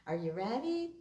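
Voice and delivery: plain reading voice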